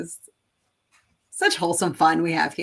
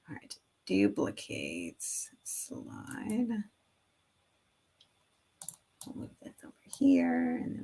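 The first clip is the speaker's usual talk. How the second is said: whisper; 14 semitones lower, 6 dB quieter than usual. Real, not acted. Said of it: "unvoiced whisper".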